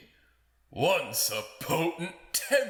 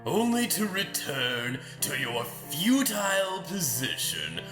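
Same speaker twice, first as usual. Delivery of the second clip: higher, sweeping, scornful challenge; theatrical taunting; 4 semitones higher than usual. Acted. Lord mocking the lady's futile position, theatrically scornful. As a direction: taunt with big swooping pitch.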